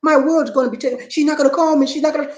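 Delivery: high-pitched panicked whine, hammed up; mock panic, voice shooting up; mock frenzy. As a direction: imitate a man frantic about losing her. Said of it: "it puts them into a tizzy, a frenzy".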